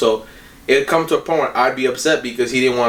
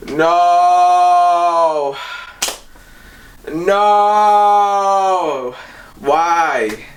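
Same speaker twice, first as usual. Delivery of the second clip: retold despair; 4 semitones higher, much slower than usual. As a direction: wail no as the thumb gets crushed. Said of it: "I'm just like".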